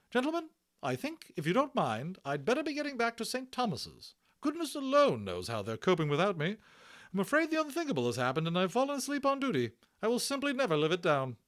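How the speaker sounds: high loud brisk nurse voice; a bustling hospital matron; hearty Matron. Acted. Matron reassuring Omri about the Indian, high and hearty.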